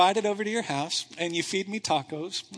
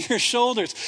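normal talking voice

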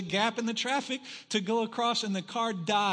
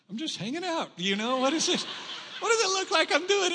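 high, loud mock-indignant retort; exasperated sarcasm; 10 semitones higher, 4 dB louder, faster than usual. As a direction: play up the exasperation at a silly question. Sarcastic retort while pushing stalled car.

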